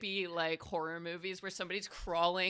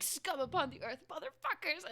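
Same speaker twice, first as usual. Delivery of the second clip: swearing in Infernal; 10 semitones higher than usual. Pretend. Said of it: "starting swearing in Infernal".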